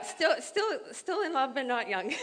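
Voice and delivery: giggling through the words; laughing. Real, not acted. Wife joking about still being in love, laughing. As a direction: laugh it out, high and light. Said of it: "laughing at herself".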